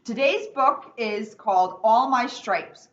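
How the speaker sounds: ordinary delivery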